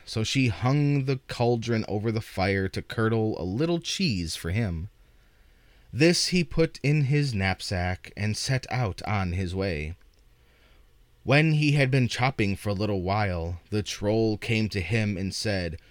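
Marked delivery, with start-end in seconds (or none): none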